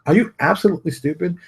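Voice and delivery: high-pitched, disbelieving question; incredulous challenge to their sense. Retold or imitated. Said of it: "I just look at them like".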